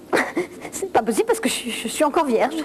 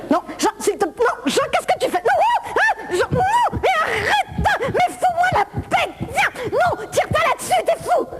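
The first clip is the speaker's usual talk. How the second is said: much higher, shrieking refusals; high hammed-up struggling cries; panicked protest; 11 semitones higher than usual. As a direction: fight him off in comic panic. Fighting off Jean's advances.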